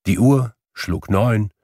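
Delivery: ordinary delivery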